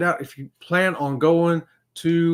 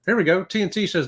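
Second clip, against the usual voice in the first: usual speaking voice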